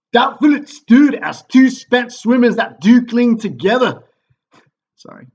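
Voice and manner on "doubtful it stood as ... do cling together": loud, high, hammed-up Scottish captain; terrible Scottish accent. Wounded captain reports the battle to the king, mock Scottish shout. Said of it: "my terrible Scottish accent when I read the part of the captain".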